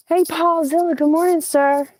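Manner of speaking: high, loud morning hello; calling a greeting. Real, not acted. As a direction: call out a high-pitched greeting to an animal.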